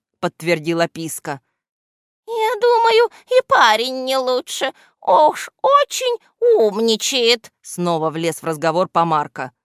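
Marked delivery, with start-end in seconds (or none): 2.3-7.5 very high, loud squeaky voice; squeaky villain sidekick, disapproving. A narrator's reading. Henchman butting in about the boy, squeaky disapproval.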